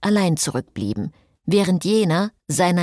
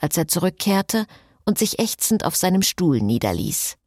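ordinary delivery

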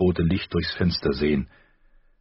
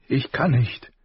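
higher, tearful plea; weeping; 8 semitones higher than usual. A narrator's reading. Caitlin tells Robb she cannot sleep, weeping. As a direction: cry it out helplessly. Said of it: "weinte sie".

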